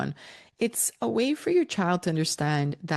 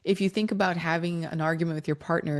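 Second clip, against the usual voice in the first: ordinary delivery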